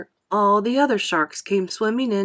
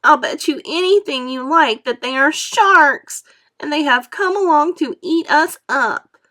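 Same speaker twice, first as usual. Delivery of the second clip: higher, louder panicky earthworm; frightened insistence; 8 semitones higher, 7 dB louder than usual. A narrator's reading. Earthworm warning everyone, frightened.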